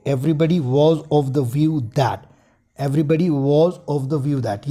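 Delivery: very slow, every word spaced out; slow dictation. Shown as a demonstration. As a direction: say it slowly while writing it on the board. Dictating the example sentence. (धीमे स्वर में वाक्य लिखवाना)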